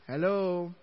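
ordinary talk

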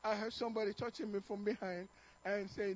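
ordinary delivery

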